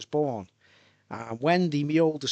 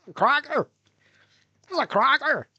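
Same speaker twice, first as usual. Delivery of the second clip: high, loud catchphrase impression; comedian impression; 11 semitones higher, 5 dB louder than usual. Riffing on the cracker catchphrase, comedian impression. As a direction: belt out the catchphrase like a comic. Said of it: "Ten Carson".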